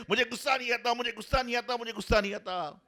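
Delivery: high, agitated repeated denial; mock furious insistence; angry denial. Man keeps angrily denying anger.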